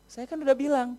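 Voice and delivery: ordinary talk, nothing marked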